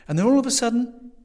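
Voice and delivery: very high, loud, urgent resumption; insistent excitement. A narrator's reading. Young man resuming interrupted recollection, loudly excited. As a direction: push back in over the interruption.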